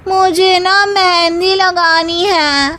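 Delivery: high, loud, slow sing-song whine; spoilt young bride. Acted. Third daughter-in-law drawls her excuse, high and loud. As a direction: stretch it out lazily.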